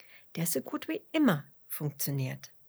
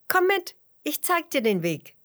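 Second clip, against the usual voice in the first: higher, louder eager invitation; rally them to come along; tatkräftige Aufforderung; 9 semitones higher, 6 dB louder than usual. A narrator's reading. Fitus offers to lead the worm. (laut und unternehmungslustig)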